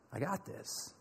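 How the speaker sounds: calm, almost whispered; hushed Jesus. Retold or imitated. Jesus answering panicking Philip, hushed.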